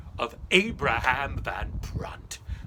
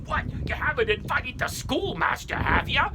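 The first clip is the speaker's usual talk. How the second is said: indignant farmer; 11 semitones higher, 7 dB louder than usual. Acted. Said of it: "He would say".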